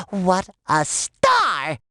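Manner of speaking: drawn-out, very slow words of awe; gushing admiration. Acted. Gushing about a robot co-star, slow drawn-out admiration. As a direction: stretch each word right out, admiringly.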